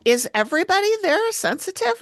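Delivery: high-pitched mock disbelief; incredulous question. Podcaster questioning whether all the staff are sensitive, incredulous. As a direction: pitch leaps up in a sceptical question. Acts out own sceptical reaction.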